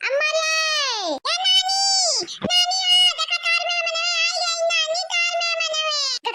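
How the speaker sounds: high, carrying call across the house. Acted. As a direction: call grandma from far off to come see the guests. Calling grandma to greet guests. (नानी को दूर से ऊँची आवाज़ में पुकारना)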